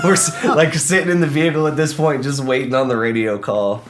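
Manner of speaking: high, loud laugh-through talk. Real, not acted. Laughing while recalling waiting.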